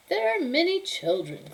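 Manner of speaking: put-on high, brusque old lady voice; dismissive old woman. Befana brushing off the kings, high curt old woman. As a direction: brush him off like a cranky crone.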